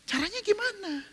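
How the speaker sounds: high squeaky whining question; pleading like a sulky child; man whining to God how to apologise; mock whine.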